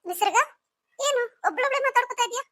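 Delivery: elder sister calling in. Acted. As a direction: pitch it up as the older sister checking in. Sister finds her talking alone.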